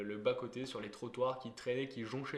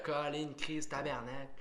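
put-on Quebec French accent; slower than usual. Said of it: "moi, je vais dire".

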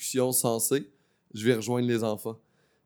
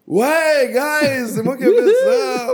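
triumphant shout; 11 dB louder, much slower than usual. Retold or imitated. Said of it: "puis j'ai fait".

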